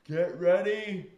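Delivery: loud, drawn-out ride send-off; tired ride operators. Retold or imitated. Ride operators to waiting riders, loud drawn-out call. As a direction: call it out slowly, stretching the words. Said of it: "those guys were tired".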